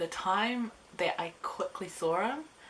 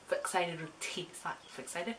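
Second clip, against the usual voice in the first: ordinary delivery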